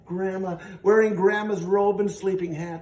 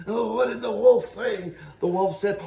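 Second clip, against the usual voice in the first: usual speaking voice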